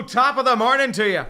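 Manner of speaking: loud, high hammed-up Irish lilt; a cheery stage-Irish top of the morning; mock Irish greeting. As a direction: belt out a fake Irish hello. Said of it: "It's not Irish".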